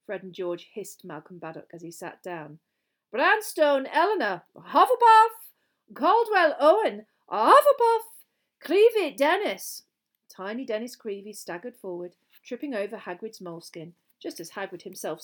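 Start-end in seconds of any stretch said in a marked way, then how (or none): 3.1-9.8 high, loud, stretched-out roll call; calling out names. Acted. Names and houses called across the hall, loud ringing call. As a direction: shout each name and house slowly.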